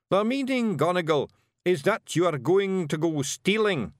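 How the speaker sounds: proud Scots proclamation; Rob Anybody declaring. Acted. Rob to the Feagles, grandly announcing the plan. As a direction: announce it to the crowd.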